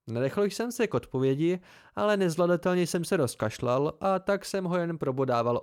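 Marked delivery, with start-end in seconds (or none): none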